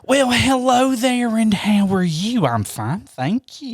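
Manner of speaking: drawn-out, high Southern lady greeting; put-on prank-call lady, swooping in pitch; Thelma Hollister. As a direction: stretch the vowels like a genteel caller. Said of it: "character called Thelma Hollister".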